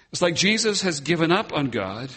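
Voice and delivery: normal talking voice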